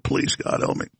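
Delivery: breathy, unvoiced little prayer for help; whispered plea. Host recalling the lingo, whispering a plea. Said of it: "It's just like".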